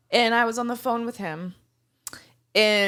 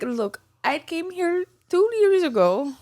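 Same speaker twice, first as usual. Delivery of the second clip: put-on Bangladeshi accent; 6 semitones higher than usual. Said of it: "in his Bangladesh accent".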